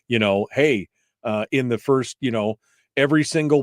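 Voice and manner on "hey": ordinary delivery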